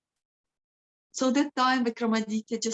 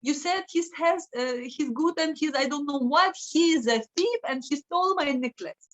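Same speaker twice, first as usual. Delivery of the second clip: upset complaint; 6 semitones higher than usual. Acted. Daughter tells her father the guest stole her necklace, high and outraged. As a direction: wail at him, hurt and furious.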